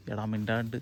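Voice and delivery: low hushed warning. Real, not acted. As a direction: keep it down, bear may be near. Hushing friend near possible bear.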